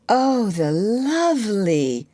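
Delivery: enchanted, pitch swelling up; admiring cry. A narrator's reading. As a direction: draw it out in rapt delight. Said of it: "she cried".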